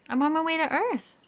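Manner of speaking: high, loud determined voice; Pluto voice. A narrator's reading. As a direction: announce it high and proud. Pluto answers Neptune.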